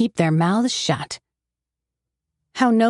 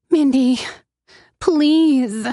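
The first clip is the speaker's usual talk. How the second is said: high, louder desperate plea; begging; 6 semitones higher, 4 dB louder, much slower than usual. Acted. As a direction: plead with her sister, voice rising. Addicted sister begs for money.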